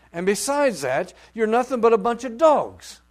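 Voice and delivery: loud, high, stern rebuke. Acted. Preacher voicing Jesus rebuking the woman, stern and loud. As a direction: hurl it at her. Jesus' harsh words voiced.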